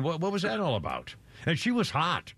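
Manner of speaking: ordinary delivery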